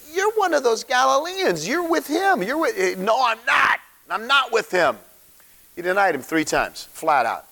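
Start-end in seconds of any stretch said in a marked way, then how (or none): 0.1-3.0 put-on servant girl accusing. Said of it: "And she goes". 3.0-5.0 panicky denial. Retold or imitated. Peter's denial.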